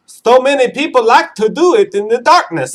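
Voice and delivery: high, odd mock voice; put-on joke voice. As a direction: pitch it way up, hammed-up and silly. Host breaks into a joke voice.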